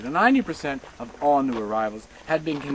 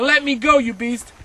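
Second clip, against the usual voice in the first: loud, higher desperate shout; shouting woman; 5 semitones higher, 8 dB louder than usual. Retold or imitated. Young woman shouting back at the guard, loud and frantic. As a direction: fling it back at him in panic. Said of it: "She shouted".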